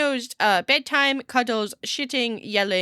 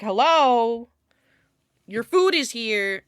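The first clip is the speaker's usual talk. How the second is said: announcing food; 3 semitones higher, 8 dB louder, slower than usual. Retold or imitated. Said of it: "be like".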